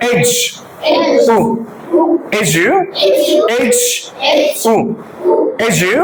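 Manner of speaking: এজু split into "ej" and a short "u", then joined; sound by sound. Shown as a demonstration. Teacher has class say together, sound by sound. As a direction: drill এজ and উ apart, then blend. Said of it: "So, let's say together".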